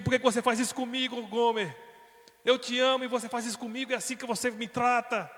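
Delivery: loud, high pleading reproach; anguished quarrel. Acted. Husband confronting his unfaithful wife, loud and anguished. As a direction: hurl the heartbreak at her.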